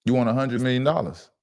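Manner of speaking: a hint of a friendly, slick general manager. GM talking to a player asking for a hundred million, lightly corporate. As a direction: greet him warmly like a front-office boss, then hedge. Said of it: "Let me hear your G M voice one more time".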